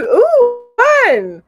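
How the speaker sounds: high, loud delighted "ooh, fun"; excited ooh. Real, not acted. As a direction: squeal with delight at the news. Reacting to viewer's project.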